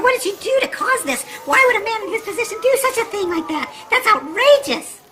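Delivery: wife rants at husband's story, high and indignant; outraged rant. Acted. Wife's outrage building up.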